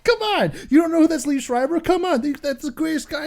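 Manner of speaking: high, eager come on, that's the greatest guy; excited fanboy. Retold or imitated. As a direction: gush at them like a thrilled fan. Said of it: "I'm like".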